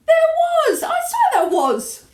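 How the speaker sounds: high, loud insistent protest; shouting back. A narrator's reading. Cook swears there was a mouse, shouting back. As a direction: protest hotly at full voice. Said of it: "the man was shouting back".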